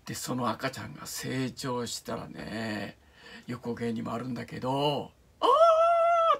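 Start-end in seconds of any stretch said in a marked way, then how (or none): 5.4-6.4 sudden piercing shriek, pitched way up and loud; imitated scream. Reviewer imitating the strange child's scream, a piercing shriek. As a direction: scream out like the creepy child in the film. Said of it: "って叫ぶわけ".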